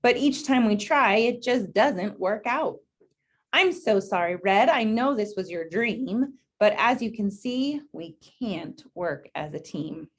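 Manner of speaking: gentle, unhurried storybook read; reading to children. Acted. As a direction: read it out to a class of kids. Reads picture book to class.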